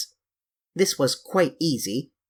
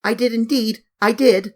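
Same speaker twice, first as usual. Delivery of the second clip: high, louder, insistent delight; half laughing, half crying; 8 semitones higher, 5 dB louder than usual. A narrator's reading. Girl insisting to her brothers she felt fur, excited. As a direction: swear it's true, overjoyed. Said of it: "half laughing and half crying".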